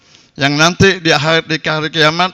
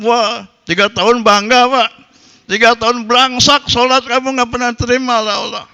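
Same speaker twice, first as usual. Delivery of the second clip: indignant scolding; 7 semitones higher than usual. Acted. Preacher scolds feuding neighbour.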